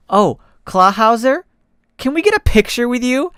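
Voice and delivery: hopeful request; eager ask. Acted.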